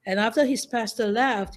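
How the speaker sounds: normal talking voice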